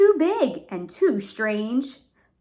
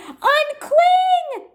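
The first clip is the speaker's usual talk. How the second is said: high, loud chanting shout; crowd shout; 7 dB louder than usual. A narrator's reading. The crowd below shouting up to Kevin, high and loud. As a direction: yell it up to the tree. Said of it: "the crowd called".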